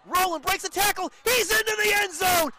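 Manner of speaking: rising to a loud excited shout; touchdown call. Real, not acted. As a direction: yell it as he scores.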